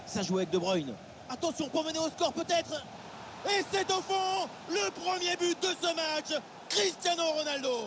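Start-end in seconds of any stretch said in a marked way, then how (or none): 1.3-7.8 excited sports commentary. Real, not acted. Goal scored, commentator erupts.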